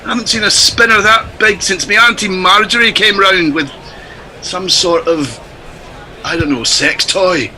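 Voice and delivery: put-on Scottish commentator voice; mock Scottish cricket call; Scottish accent, playing it for laughs. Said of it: "in this accent".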